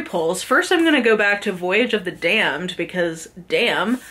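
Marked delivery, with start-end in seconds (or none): none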